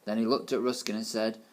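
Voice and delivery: usual speaking voice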